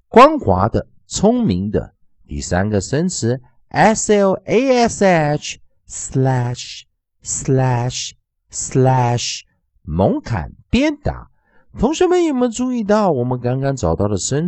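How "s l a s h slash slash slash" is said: letters spelled, then "slash" repeated clearly; spelling drill. Shown as a demonstration. Teacher leading students through new words, deliberate drill. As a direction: model "slash" for the class to copy. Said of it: "同学们跟着老师练习一下".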